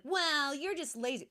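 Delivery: high, loud judgmental "well"; mock someone looking down on the poor; judgmental scolding. Imitating people who judge the homeless, judgmental scolding. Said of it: "from the spirit of like".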